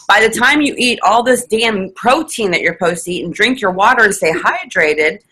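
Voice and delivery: ordinary talk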